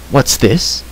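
mildly startled bard; startled question. A narrator's reading. Bard hearing of armed hosts, mildly startled. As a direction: ask with a touch of alarm. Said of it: "cried Fluter".

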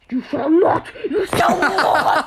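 dramatic shout. Acted. Friend quotes a dramatic movie line.

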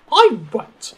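high, loud haughty protest; stubborn refusal. Acted. Daisy refuses to pull the van, stubborn. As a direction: refuse flatly in a high-pitched huff.

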